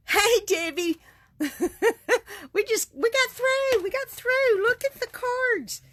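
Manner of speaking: greeting Debbie, thrilled the cards are done, excited. Real, not acted. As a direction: gush to a friend who just walked in.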